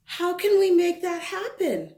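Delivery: put-on high, eager little girl asking; bright child voice. Child character asks.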